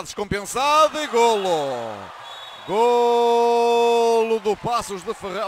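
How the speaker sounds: long drawn-out goal yell; explosive goal commentary. Real, not acted. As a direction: stretch out the goal call, loud and high.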